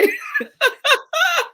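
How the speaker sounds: high, teary laugh after crying; tearful laugh. Real, not acted. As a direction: let a wet laugh break out.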